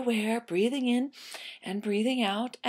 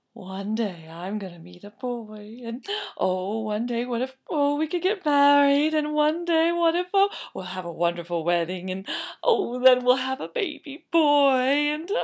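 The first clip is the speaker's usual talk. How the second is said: put-on dreamy young girl; 3 semitones higher than usual. Girl dreaming of wedding and baby, dreamy. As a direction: sigh it out, building higher. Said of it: "she dreamed about".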